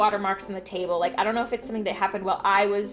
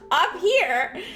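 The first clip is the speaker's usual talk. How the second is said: high, coaxing pet voice; talking to the cat; 6 semitones higher, 3 dB louder than usual. Retold or imitated. Retelling how she answered her cat, high pet-talk voice. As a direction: call the cat up like a pet owner.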